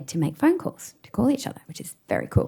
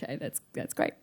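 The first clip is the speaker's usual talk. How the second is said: awkward hush; 4 semitones lower, 15 dB quieter than usual. Retold or imitated. Awkward reply to classmate.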